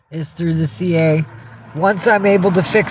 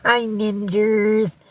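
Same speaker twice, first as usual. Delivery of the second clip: high, drawn-out cutesy greeting; higher cat voice, stretched out; voicing the cat; 6 semitones higher, much slower than usual. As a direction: sing out a slow hello for your pet. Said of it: "Cat says".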